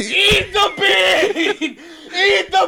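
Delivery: high, very slow laughing shout; hotheaded shouting. Real, not acted. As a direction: chant it, laughing. Player shouts at opponent.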